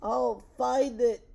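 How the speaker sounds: loud, high, drawn-out vow; crying out. A narrator's reading. Elephant vows to find his lost friends, crying out. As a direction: cry it out with determination. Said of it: "cried Horton".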